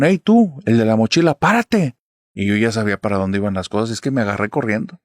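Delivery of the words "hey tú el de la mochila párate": imitating a thug calling out, high and louder; retold yell. Gang thug yelling after the boy with the backpack, a retold shout. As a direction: bark it after a fleeing kid as a mock street yell. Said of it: "nada más me gritaron".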